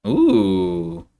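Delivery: high, loud delighted ooh; impressed ooh. Real, not acted. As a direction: gasp of pleasant surprise. Impressed by a topdeck.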